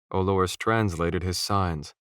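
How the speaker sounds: ordinary delivery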